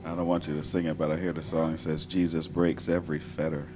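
ordinary delivery

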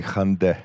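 Hyundai as HUN-deh, two short syllables; two-part Hyundai. Shown as a demonstration. Caller gets it right for the helper, Hyundai in two parts as hun-deh. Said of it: "Yes, correct".

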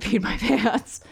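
ordinary talk, nothing marked

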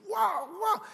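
high imitated yelling of a fighting couple; wordless squawk imitating a shouted quarrel; mock screaming noises. Husband and wife fighting with frying pans, imitated screaming. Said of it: "empiezan a gritarse y a aventarse sartenazos".

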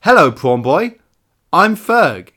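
high, loud greeting to a character. Pretend. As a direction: call out cheerfully to Prawn Boy. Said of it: "try talking to him".

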